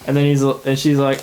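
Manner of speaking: ordinary delivery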